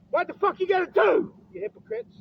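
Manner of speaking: high, loud outburst; angry shouted challenge. Real, not acted. Man challenging hypocrites about coming persecution, angry shout. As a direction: shout the question in anger.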